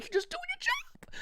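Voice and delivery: high, strained squeal of reassurance; pained screaming. Retold or imitated. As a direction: reenact squeaking through the pain, slowly. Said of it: "And I'm just screaming".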